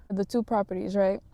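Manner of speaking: ordinary talk, nothing marked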